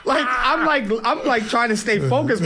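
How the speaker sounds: high, laughing through the words; laughing while talking. Real, not acted. Boxer still laughing, telling the host about the bear. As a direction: keep laughing as you tell it.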